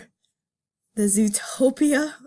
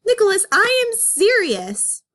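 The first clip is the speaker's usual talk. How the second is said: much higher, louder stern call; stern full-name scold; 11 semitones higher, 6 dB louder than usual. Acted. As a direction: snap his full name. Judy scolds Nick.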